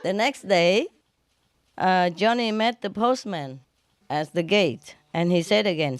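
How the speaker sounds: ordinary delivery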